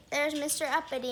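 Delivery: ordinary delivery, nothing marked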